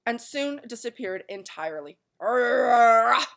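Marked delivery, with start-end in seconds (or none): 2.2-3.3 loud growling arrgh; dog's roar. A narrator's reading. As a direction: bark out a furious roar. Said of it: "exclaimed the watchdog".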